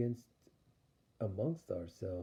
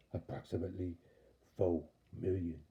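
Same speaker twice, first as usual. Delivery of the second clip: put-on cool synthetic sci-fi girl; tally it like a computer; robotic sci-fi voice; 3 semitones lower than usual. Sci-fi chick tallies the amount.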